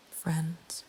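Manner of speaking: ordinary delivery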